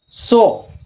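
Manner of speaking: सो lifted, long rounded o; model word so. Shown as a demonstration. Teacher models सो for students, long o. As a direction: sing out the o in सो. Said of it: "इथे सुद्धा तसं उच्चारण आलंय".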